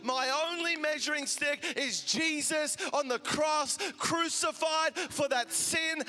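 loud, forceful rebuke, slow and hammered; loud spiritual rebuke; rebuking a spirit. Acted. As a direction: cast it out with loud authority. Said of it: "And it was like I had discernment".

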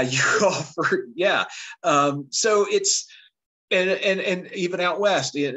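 normal talking voice

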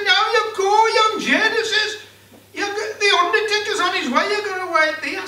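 high, loud bossy voice through laughter; laughing wife. Acted. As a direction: order him about, laughing. Wife orders dead husband.

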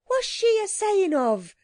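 high-pitched puzzled street cry; crowd's cry. A narrator's reading. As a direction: call out like a baffled onlooker. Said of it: "cried a dozen voices".

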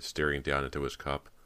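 usual speaking voice

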